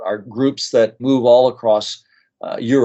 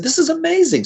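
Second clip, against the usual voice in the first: much higher, wide-eyed amazement; amazed. Retold or imitated. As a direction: exclaim in retold wonder. Reacting to the veterans' story.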